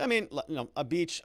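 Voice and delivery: ordinary delivery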